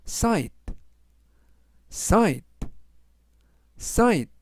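"sight" said three times as SITE, the gh silent; pronunciation drill. Shown as a demonstration. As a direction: clip "sight" cleanly on each take. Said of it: "라고 발음하시면 되겠고요". ("sight"를 세 번 끊어서 발음 시범)

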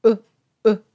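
clipped "う" with pushed-out lips; short back-of-throat u. Shown as a demonstration. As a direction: round the lips as if to whistle and cut "う" short. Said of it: "喉の奥の方から短く".

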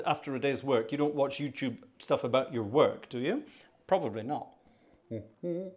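normal talking voice

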